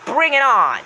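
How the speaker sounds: high, loud battle cry; Danny's voice. Retold or imitated. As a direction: shout the challenge, swooping down at the end. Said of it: "He says".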